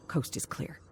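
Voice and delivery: breathy whisper to himself. Acted. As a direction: whisper so nobody in the hall hears. Sneaking back from terminal.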